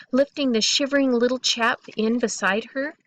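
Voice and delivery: ordinary delivery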